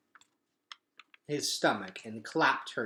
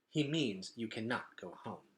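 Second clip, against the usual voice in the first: flat; 2 semitones lower, 10 dB quieter than usual. Acted. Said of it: "said Luxa flatly".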